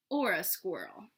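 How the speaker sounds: plain reading voice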